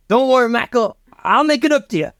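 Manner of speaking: high-pitched cowboy promise; reassuring cowboy father. Acted. Cowboy father to son dangling over the volcano, high earnest promise.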